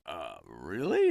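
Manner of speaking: low, slow, doubtful double-check; retold disbelief. Actor questioning the director about his character's demise, low slow disbelief.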